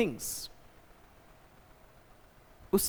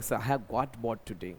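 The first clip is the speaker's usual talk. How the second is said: slow, softer, lower student's complaint; bored student voice; 9 semitones lower, 8 dB quieter, much slower than usual. Shown as a demonstration. Teacher plays a bored student talking to sir, slow and soft. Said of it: "तब बोलेंगे".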